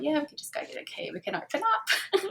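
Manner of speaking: hammed-up innocent voice of silly little me; naive silly self. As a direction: play your own naive younger self, carefree. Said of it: "silly little me thought".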